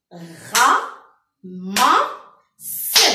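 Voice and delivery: loud "Ra, ma, se" with a pause after each; three strong separate syllables, "Ra, ma, se"; split into syllables. Shown as a demonstration. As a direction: beat out "Ra... ma... se" for counting. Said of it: "Combien de fois je frappe".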